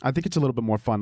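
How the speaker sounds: usual speaking voice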